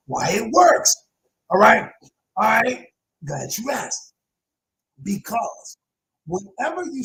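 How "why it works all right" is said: usual speaking voice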